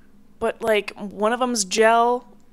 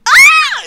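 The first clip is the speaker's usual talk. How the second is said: high shrieking squeal of embarrassment; cringe squeal; 14 dB louder than usual. Real, not acted. Telling her viewers her theme, a cringing high squeal. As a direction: squeal at how corny it sounds.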